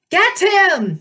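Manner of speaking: high, loud screech of an order; screamed command. A narrator's reading. Snake woman orders the monsters after the hero, screaming. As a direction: scream for the others to attack. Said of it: "she screamed".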